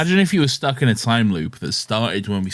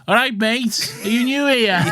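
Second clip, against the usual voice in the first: much higher, breezy blokey greeting; Dan's casual voice; 12 semitones higher than usual. Retold or imitated. Dan bluffs the Dalek with a box, high chummy mock greeting. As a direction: chirp it like a chummy coworker. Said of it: "he's like".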